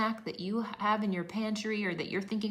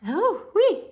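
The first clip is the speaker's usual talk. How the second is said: high, loud burst of passion; real, overflowing excitement; excited whoop; 13 semitones higher, 13 dB louder than usual. Real, not acted. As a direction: let out a whoop of feeling. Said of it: "I'm just very passionate about this".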